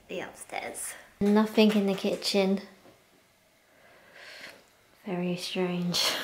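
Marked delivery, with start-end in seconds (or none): 5.1-6.1 whispering. Real, not acted. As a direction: breathe it out in a whisper. Whispering in the empty house.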